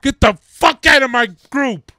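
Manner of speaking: loud, high shout; angry shout. Acted. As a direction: yell him out of the band. Mock band fight, throwing him out.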